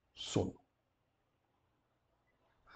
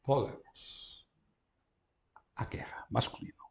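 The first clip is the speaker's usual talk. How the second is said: whispered aside; 7 dB quieter than usual. Real, not acted. Whispering while writing on board.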